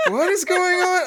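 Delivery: high, incredulous squeal of amusement; laughing bafflement. Retold or imitated. Reacting to an absurd movie rule, laughing bafflement.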